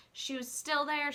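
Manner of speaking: ordinary delivery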